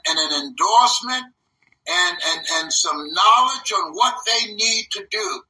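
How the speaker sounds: ordinary talk, nothing marked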